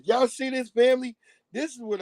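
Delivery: excited call-out. Real, not acted. Hyping the audience.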